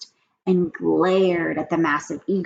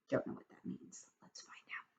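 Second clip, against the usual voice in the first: soft secret whisper; 18 dB quieter than usual. Real, not acted. Reader aside to children.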